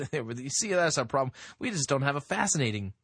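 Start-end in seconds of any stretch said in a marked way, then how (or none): none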